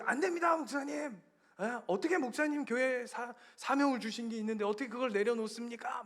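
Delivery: high, mock-dismayed protest easing down; pleading congregant. Acting congregation protesting pastor's leave. (성도가 높은 목소리로 만류하는 연기)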